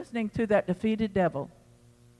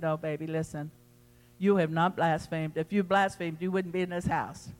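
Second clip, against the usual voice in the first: softer, gentle pastor reassuring a worried member; 3 semitones lower, 5 dB quieter than usual. Retold or imitated. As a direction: soothe them quietly, like calming a child. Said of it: "I said".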